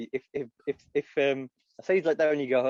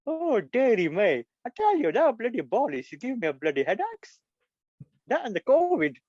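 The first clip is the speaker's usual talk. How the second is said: put-on Indian accent; 9 semitones higher, 4 dB louder than usual. Comic impressionist moaning about Boris, Indian accent. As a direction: wail it in a hammed-up Indian accent. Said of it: "He did the voice".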